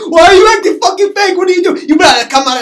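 high, fast screamed comeback; acted furious shout. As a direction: yell back at full pitch in the retold fight. Acted quarrel, shouting back.

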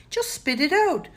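high-pitched impatient moan; exasperated plea. A narrator's reading. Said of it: "moaned Danny".

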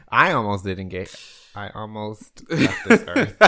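talking through laughter; laughing. Real, not acted.

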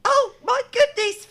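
put-on high, relieved old lady; relieved granny. A narrator's reading. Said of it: "Granny said".

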